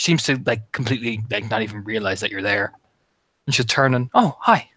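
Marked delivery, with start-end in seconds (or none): 4.1-4.7 higher, louder little hello; surprised greeting. Acted. Girl turning to the newcomer she hadn't noticed, mildly surprised. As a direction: greet someone you only just noticed.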